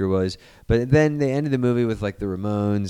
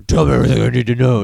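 fast, loud growled interrogation; loud, rushed demand in a mock Batman growl; gravelly Batman voice; 9 dB louder, much faster than usual. Spider-Man interrogating the gangster in a put-on Batman voice. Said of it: "It's like a Batman voice".

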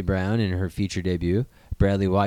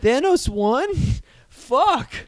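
high, shocked disbelief; a pretend wail of dismay at the news; mock shock; 14 semitones higher, 4 dB louder, slower than usual. Podcast host fakes horror that the villain won, high mock shock. Shocked at fake plot twist.

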